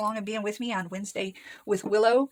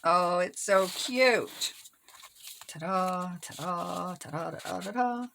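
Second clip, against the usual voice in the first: slow cooing, lilting "Ta-da"; singsong ta-da; much slower than usual. Real, not acted. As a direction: present it like a little fanfare. Admiring finished pocket, singsong.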